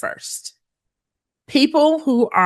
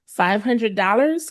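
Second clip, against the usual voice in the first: "Five hundred dollars" rising on "dollars" like a question; rising intonation; 3 semitones higher than usual. Shown as a demonstration. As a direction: let "dollars" climb into a question mark. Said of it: "The rising intonation sounds like".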